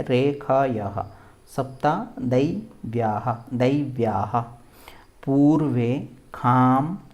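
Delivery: careful, separated words with swinging pitch; word-by-word repeat. Shown as a demonstration. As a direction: leave a gap after each word. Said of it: "फिर से बोलेंगे इसको".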